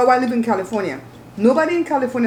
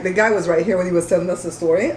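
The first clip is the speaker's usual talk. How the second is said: ordinary talk, nothing marked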